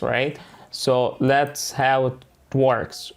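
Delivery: usual speaking voice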